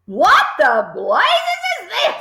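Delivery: high, slow furious demand; angry yell. A narrator's reading. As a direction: roar it out, shaken. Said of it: "yelled the Trunchbull".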